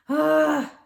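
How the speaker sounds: big exaggerated huff; loud fed-up sigh; loud groaning sigh. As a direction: heave a long theatrical sigh. Child weary of routine.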